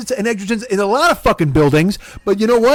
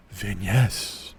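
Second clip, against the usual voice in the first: much lower, quiet mumble of Van Ness; mock low mumble; 12 semitones lower, 8 dB quieter than usual. Mocking the friend's reply, low quiet mumble. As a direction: mumble it lazily.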